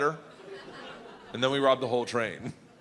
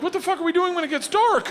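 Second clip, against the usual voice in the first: excited yelling; 14 semitones higher, 5 dB louder than usual. Retold or imitated. Acted friend asking loudly.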